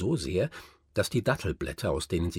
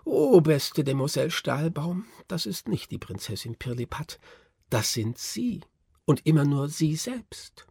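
plaintive sigh; 7 semitones higher than usual. A narrator's reading. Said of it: "Nussknacker seufzte aber fast kläglich".

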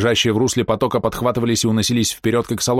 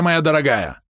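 shouting; 4 semitones higher than usual. A narrator's reading. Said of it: "кричал Таутон".

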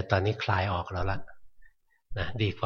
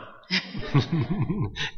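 brief amused laugh, pitched up; short laugh; 8 semitones higher than usual. Real, not acted. Teacher amused by the student's modest answer, short laugh. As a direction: laugh it off lightly.

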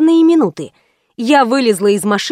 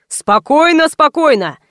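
higher, lightly booming call for order; mild thundering; 4 semitones higher than usual. A narrator's reading. Fyodor calming the crowd, thundering mildly. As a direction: project it over the crowd, understated. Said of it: "загремел Фёдор".

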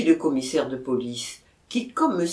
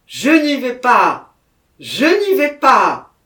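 loud, high, slow refusal; panicked cry; 7 semitones higher, 12 dB louder, slower than usual. A narrator's reading. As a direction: shout it out in dread. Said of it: "s'écria Jacquemin".

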